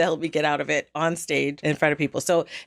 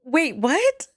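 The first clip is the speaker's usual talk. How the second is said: high, stunned double-take; high-pitched disbelieving question; retold shock; 10 semitones higher than usual. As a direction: re-enact being floored by the news. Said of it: "I was so in shock".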